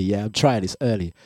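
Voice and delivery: ordinary talk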